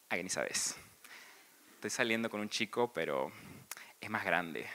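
casi en susurro, confesando; cuéntalo bajito como secreto; confidencia. Retold or imitated. Amiga cuenta en confianza. (confidencia en voz baja)

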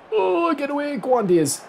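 sudden high yelp, urging the striker on; jumping out of the seat with excitement; excited yelp. Real, not acted. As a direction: leap up in pitch and cheer him on. Big chance on goal.

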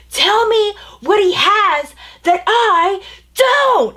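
high, loud, drawn-out mock yell; furious yelling. Drunk coworker demanding answers of the storyteller, imitated high slow yell. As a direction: yell each word slowly, imitating someone losing it. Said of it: "losing his mind and yelling".

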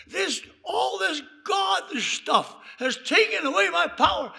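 loud, high, emphatic accusation; retold screaming complaint. Teacup reader blaming the God talk, retold scream. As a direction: blame it loudly, imitating her scream. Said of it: "And she screamed".

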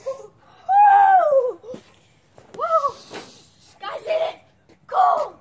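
yelling woo from the cold; cold shock shouting. Real, not acted. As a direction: shout it out, freezing in the snow. Running out into the snow.

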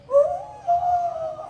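long very high mock wail; high drawn-out horrified oh; high shocked wail. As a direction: let out a long shrill oh. Realizing he'll be eaten.

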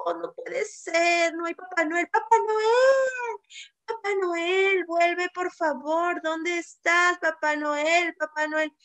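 slow, drawn-out pleading for Papá Noel; a sad reindeer begging Papá Noel to come back; sad reindeer calling. Acted. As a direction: sad reindeer calling for his friend, slowly. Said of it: "Y dijo".

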